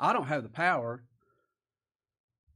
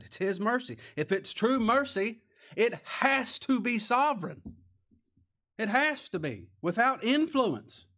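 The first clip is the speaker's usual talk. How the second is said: higher, louder, driving sermon climax; preaching at full force; 8 semitones higher, 6 dB louder than usual. Acted. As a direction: preach it hard, hammering each phrase.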